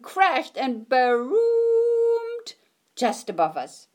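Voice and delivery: dramatic booming narration; booming climax. A narrator's reading. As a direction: rise up big with the thunder.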